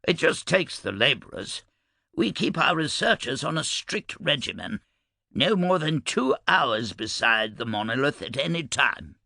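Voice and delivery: breezy, lilting explanation; unconcerned. A narrator's reading. Cressel explains the work regimen to uneasy Mikkel, unconcerned. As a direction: explain it lightly, as mere routine.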